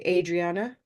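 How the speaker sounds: Adriana starting with a gray AY sound; gray first syllable. Shown as a demonstration. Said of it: "Maybe they make this gray".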